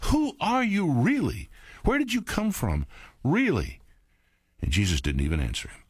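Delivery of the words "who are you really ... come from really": lightly voiced Pilate wondering; searching question. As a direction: press him for the truth.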